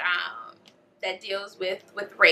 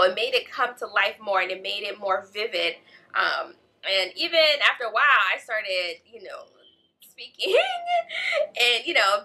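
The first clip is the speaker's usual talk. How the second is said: ordinary talk, nothing marked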